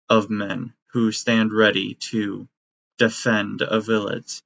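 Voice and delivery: ordinary delivery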